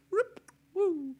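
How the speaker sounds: high loud whoosh of a Jedi push; mock force push whoosh. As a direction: sell the invisible shove with a squeal. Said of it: "pushing sound effect".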